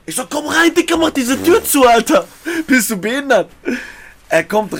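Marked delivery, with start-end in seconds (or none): 0.3-3.5 high, loud urgent command. Retold or imitated. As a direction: hurry him in, loudly. Hissing at him to shut the door.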